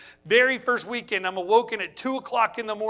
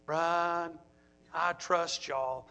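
lower, much softer, slow and unbothered; calm dad; 5 semitones lower, 8 dB quieter, much slower than usual. Retold or imitated. The boy's dad answers the worried houseparent, softly unbothered. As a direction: say it low and easy, no worry at all. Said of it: "he said to me".

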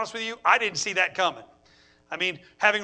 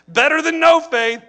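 laughing; 7 semitones higher, 8 dB louder than usual. Shown as a demonstration.

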